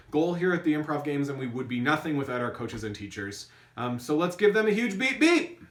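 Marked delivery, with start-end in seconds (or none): 3.8-5.6 vocal beeps. Pretend. Cheering coaches with vocal beeps.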